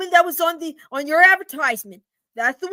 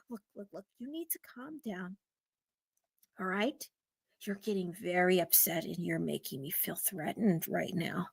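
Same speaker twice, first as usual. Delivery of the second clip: much softer and lower, timid; frightened woman; 9 semitones lower, 14 dB quieter than usual. Acted. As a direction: plead quietly, scared. Woman scared, softly pleading.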